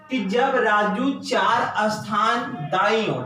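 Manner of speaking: slow, deliberate reading of the question. Shown as a demonstration. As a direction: lay each word out for students. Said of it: "यहां से कह रहा है". (धीमी, ठहरकर प्रश्न पढ़ना)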